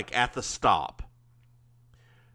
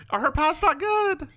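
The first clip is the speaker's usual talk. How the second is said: squealed question, much higher; high-pitched disbelief. Real, not acted. Host teases co-host over the celebrity's pies, high squeal. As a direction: squeak it out through laughter. Mock-scandalised question through laughter.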